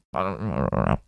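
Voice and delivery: a low, mumbling mock Gaddafi, trailing off slowly; light Gaddafi impression, low and dragged out; imitating Gaddafi. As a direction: mumble it like a rambling old dictator, half in jest. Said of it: "sometimes Gaddafi's like this".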